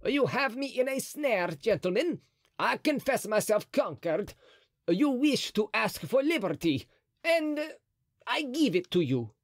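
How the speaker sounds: high, yielding cardinal. A narrator's reading. Mazarin's voiced speech.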